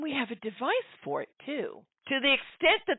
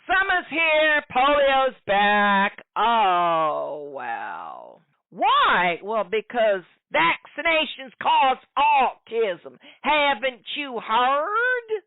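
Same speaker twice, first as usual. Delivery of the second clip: mock Appalachian hillbilly, higher, louder, slow; 5 semitones higher, 6 dB louder, much slower than usual. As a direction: play the ignorant Appalachian anti-vaxxer for mockery. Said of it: "sounding like the two-bit backwater Appalachian hick hillbilly".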